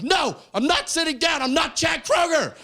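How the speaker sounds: high, shouted comic tantrum; mock outraged refusal. Refuses security, retold outburst.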